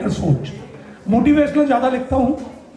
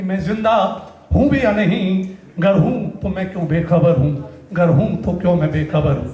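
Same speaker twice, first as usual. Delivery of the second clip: closing verse, dropping lower in pitch; stage poet's falling cadence; poetry recitation; 4 semitones lower than usual. Acted. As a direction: let the poem settle down to a low ending. Closing lines of the poem.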